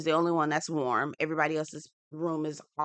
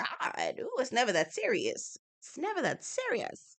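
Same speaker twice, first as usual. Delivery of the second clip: high soft breezy dismissal, lightly voiced; airy mock sigh; 7 semitones higher, 5 dB quieter than usual. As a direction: float it off, unbothered. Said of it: "I was like".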